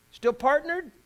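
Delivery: high, loud challenge; high and loud rhetorical jab; pointed question. Real, not acted. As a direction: poke at the congregation with it.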